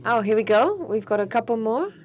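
normal talking voice

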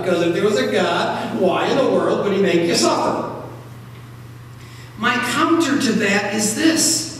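normal talking voice

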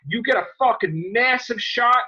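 ordinary talk

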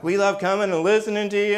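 preacher lightly voicing eager evangelicals; gushing evangelicals. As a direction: bubble over as the visitors. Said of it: "they're like".

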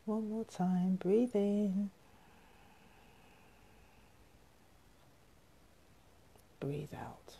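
soft, unhurried breathing cues with long pauses; guided meditation. Real, not acted. Teacher guiding viewers through three gentle breaths, soft meditation voice. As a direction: hush the voice and let each instruction settle.

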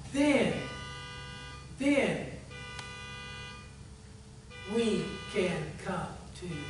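earnest pastoral prayer, swelling then fading away. Acted. Pastor praying over the congregation at the invitation, earnest prayer voice. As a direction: pray it out, rising to a plea then hushing to amen.

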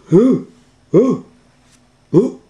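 very high, loud fearful whimpers; scared dog ooh. Acted. Scooby whimpers in fright, very high and loud.

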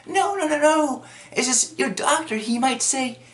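high, flustered backpedalling act; mock scared. She hurries to explain about the doctor, mock scared. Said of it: "she's just getting all scared".

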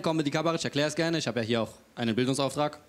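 ordinary talk

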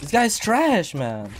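annoyed rant. Real, not acted. Raging at opponent after dying.

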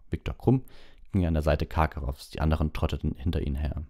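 plain reading voice

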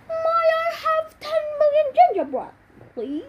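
high, sweet pleading voice; squeaky begging voice. Pretend. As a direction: beg like a cute little kid.

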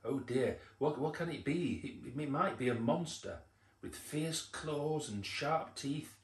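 hammed-up fright, slowing over the scary details; frightened. A narrator's reading. Frightened animals guessing at a monster, acted alarm. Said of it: "Now the animals looked frightened".

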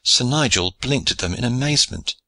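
plain reading voice

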